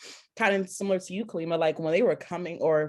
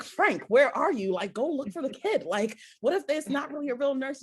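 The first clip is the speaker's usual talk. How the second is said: higher, paranoid urging; mock alarmed; 8 semitones higher than usual. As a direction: send husband after the kid. Urging husband to follow nurse.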